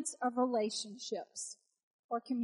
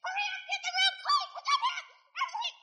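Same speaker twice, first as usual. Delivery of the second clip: put-on high shrill hurry-up yell at the kids; mock frazzled mom shouting orders; hammed-up mom yelling at the kids to clean up. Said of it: "the mom that have been like".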